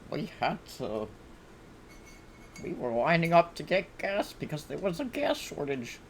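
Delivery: high, put-on elderly storyteller voice; park-bench oldster rambling on, pitched high; reminiscing old person. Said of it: "old people who sits on a park bench and just like".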